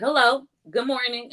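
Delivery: high, sugary fake-friendly hello; bright sarcastic good morning; mock cheerful greeting. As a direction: greet him sweetly to needle him. Cheery greeting to angry neighbor.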